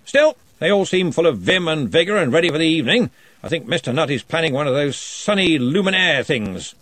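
jovial lordly wizard; booming Arch-Chancellor. A narrator's reading.

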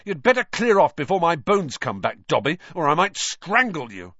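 cross schoolboy threat; fierce. A narrator's reading. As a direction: warn him off fiercely. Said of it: "he said fiercely".

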